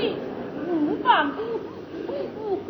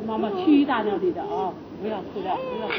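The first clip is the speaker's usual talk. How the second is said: put-on lower, soft cooing to a child; soothing hush; 4 semitones lower, 3 dB quieter than usual. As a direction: rock the child quiet. Mother hushes child again. (轻声细语哄孩子)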